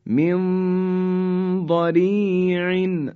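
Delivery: من ضریع recited very slowly, the ن of من nasalized for two counts before ض; slow Quranic recitation with a held nasal; ikhfa recitation. Shown as a demonstration. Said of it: "همراه با غنه و دو حرکت". (تلاوت من ضریع با غنه دو حرکت)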